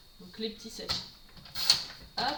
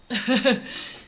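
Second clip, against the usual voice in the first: quick amused laugh; a brief laugh at herself; short laugh; 5 dB louder than usual. Real, not acted. Laughs at her ink change. (petit rire amusé)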